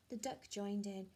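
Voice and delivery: ordinary delivery, nothing marked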